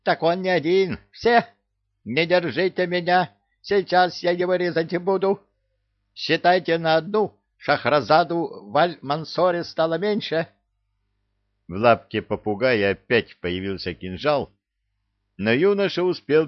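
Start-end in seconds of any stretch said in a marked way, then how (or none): 0.0-10.5 high, excited bird rant; raging parrot. Acted. Parrot threatening to cut up Шахразада, high frantic rant. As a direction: threaten wildly in a squawky voice.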